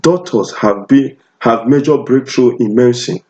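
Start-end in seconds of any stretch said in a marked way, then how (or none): none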